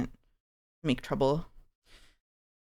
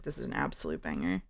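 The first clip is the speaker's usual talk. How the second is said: deep voice; 10 dB quieter than usual. Retold or imitated. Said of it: "I was like".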